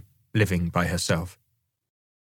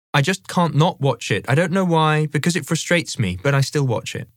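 higher-pitched, helpless mother voice; exasperated mum; 6 semitones higher than usual. Acted. Mum explaining why she still watches racing, exasperated. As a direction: voice Mum admitting a frustrating habit.

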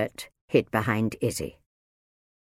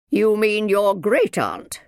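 slow, loud, raised correction; correcting; 9 semitones higher, 6 dB louder, slower than usual. A narrator's reading. Minder corrects Izzy about her aunt, pointed. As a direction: put the child right, slowly and loudly.

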